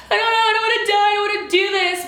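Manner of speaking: high, loud, rushed mock panic; dramatic. Scared patient refusing surgery, dramatic mock wailing. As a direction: wail it like a hammed-up meltdown. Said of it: "dramatic enough to be like".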